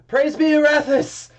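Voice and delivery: high, loud joyous cry; exultant proclamation. Acted. Priest cries out praise to the entire cathedral.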